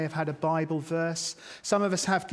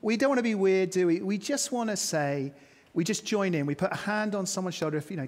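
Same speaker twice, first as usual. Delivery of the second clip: normal talking voice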